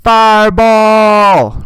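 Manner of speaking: long, high, full-volume mock yell; imitated yell. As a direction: holler it like a party chant. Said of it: "every time she'd yell".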